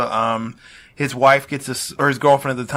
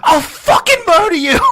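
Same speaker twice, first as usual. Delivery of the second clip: much higher, louder hammed-up scream; mock furious yell; 7 dB louder than usual. Ray Liotta screaming at the beaten neighbor, mock yell. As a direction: scream the threat like a madman. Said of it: "he goes".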